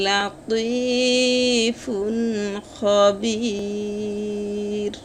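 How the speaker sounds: লাতীফুন chanted slowly with lips rounded on the heavy ত; rounded emphatic ত. Shown as a demonstration. Teacher modelling the rounded ত twice for students, slow chant. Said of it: "যেমন".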